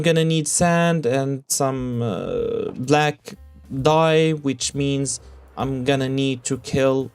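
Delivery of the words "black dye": ordinary talk, nothing marked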